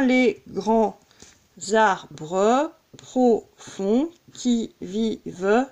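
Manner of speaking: slow scansion, syllable by syllable; deliberate, measured verse count. Shown as a demonstration. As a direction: arbres split as "ar-breu", each beat apart. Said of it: "On tombe également sur un alexandrin".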